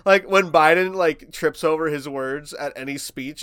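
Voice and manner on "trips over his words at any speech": normal talking voice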